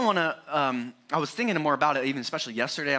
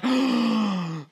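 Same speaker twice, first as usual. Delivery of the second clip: mock gasp; 5 semitones higher, 3 dB louder than usual. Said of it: "She's like".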